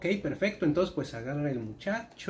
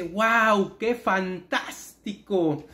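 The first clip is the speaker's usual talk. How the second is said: guau más fuerte, fantástico muy lento; asombro fingido; 4 semitones higher, 4 dB louder, much slower than usual. Acted. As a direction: estira el fantástico, maravillado. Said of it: "El hombre dice". (entusiasmo exagerado y lento)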